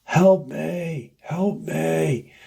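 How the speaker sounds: high, drawn-out mock cry for help; retold scream for help. Missing man cries out unseen to his friends, retold scream for help. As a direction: imitate a distant scream without real volume. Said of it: "There's just a scream".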